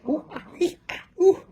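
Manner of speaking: high rising monkey hoots; monkey noises. Retold or imitated. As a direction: hoot like a monkey. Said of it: "the monkey is like".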